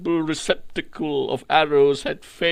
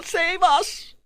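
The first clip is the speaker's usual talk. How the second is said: high, pleading cries of captive women; desperate high-pitched pleas, rising to a shout; screaming in agony; 8 semitones higher than usual. A narrator's reading. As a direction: cry out for rescue in anguish, much higher. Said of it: "They were screaming in agony".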